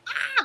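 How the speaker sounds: high mock scream of pain; imitated scream. Imitating the boyfriend screaming in the fight, high mock yell. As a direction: squeal like the guy being twisted. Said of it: "he was screaming".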